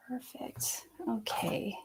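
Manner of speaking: soft whisper to herself; whispered aside. Real, not acted. Reader blocking a troll, whispering to herself. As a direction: murmur it under your breath off-mic.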